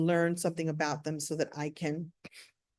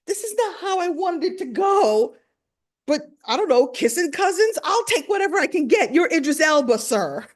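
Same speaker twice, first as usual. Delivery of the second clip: high, loud comic dismay; protest, then give in eagerly; flustered inner monologue; 9 semitones higher, 6 dB louder than usual. Retold or imitated. Host thinking mid-hug, comically flustered. Retelling her panicked inner thoughts.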